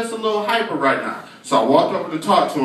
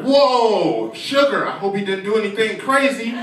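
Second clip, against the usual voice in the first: higher, exclaiming dad voice; jovial father; 4 semitones higher than usual. Acted. Father to nervous teacher about the sugar, breezy exclamation. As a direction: start on a big drawn "whoa". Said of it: "He's like".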